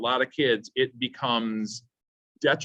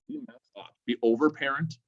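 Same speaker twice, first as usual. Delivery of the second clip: ordinary talk, nothing marked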